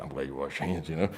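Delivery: quietly defuse the tension; hushed calming. Retold or imitated. Preacher softly calms the angry construction dude.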